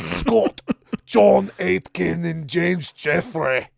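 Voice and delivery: a lightly done slow Scottish accent; a hint of Scottish on the names. Shown as a demonstration. Host tries a light Scottish accent on the doctors' names. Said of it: "Did I sound Scottish a little bit?".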